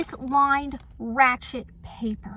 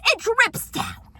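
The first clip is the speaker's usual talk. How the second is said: high, loud and slow; exasperated outburst; 9 semitones higher, 6 dB louder, slower than usual. Acted. Vlogger explaining rain to the neighbor, exasperated. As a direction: hammer home the obvious. Arguing back at the note.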